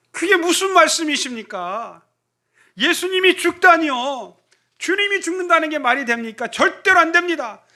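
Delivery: high, loud, pleading protest; 항변하는 베드로. Acted. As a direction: protest fiercely as Peter refusing his master's death. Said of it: "주님의 말씀에 항변합니다". (스승의 죽음을 막으려 항변하는 베드로, 높고 큰 목소리)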